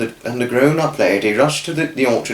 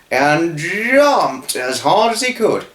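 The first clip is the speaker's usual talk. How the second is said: effortful jump; 5 semitones higher, slower than usual. A narrator's reading. Narrated big effortful jump.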